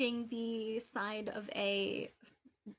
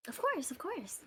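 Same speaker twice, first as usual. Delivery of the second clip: shopkeeper voice; 5 semitones higher than usual. Acted. GM voicing Adabra.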